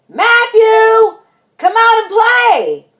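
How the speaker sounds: high, loud holler to a friend; high-pitched calling shout. Acted. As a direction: call out for a playmate at full voice.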